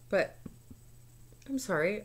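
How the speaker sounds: ordinary talk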